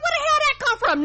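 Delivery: high mock wife voice, incredulous; imitating the wife. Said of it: "She was like".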